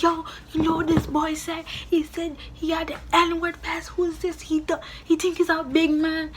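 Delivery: high, heated outraged rant; high indignant gossip to a friend; outraged retelling. Retold or imitated. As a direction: vent the news to a friend, fired up. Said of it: "I was acting like that".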